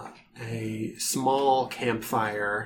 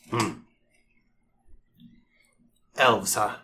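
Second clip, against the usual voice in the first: lower, wary orc guard; a gruff orc sizing them up; 4 semitones lower than usual. Acted.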